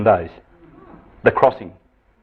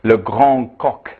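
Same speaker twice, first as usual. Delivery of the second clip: French phrase; much slower than usual. Retold or imitated. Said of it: "They said".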